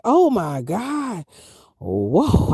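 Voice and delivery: a slow drawn-out oh my God from the mountain road; slow awed dread. Retold or imitated. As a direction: stretch it out slowly in mock fear. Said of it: "I was like".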